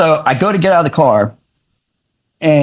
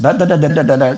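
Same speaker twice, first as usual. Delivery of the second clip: imitated rambling; faster than usual. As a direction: run of nonsense "da da" syllables. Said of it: "just rambling on".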